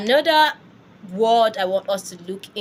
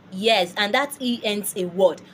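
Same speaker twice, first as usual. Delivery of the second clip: ordinary talk, nothing marked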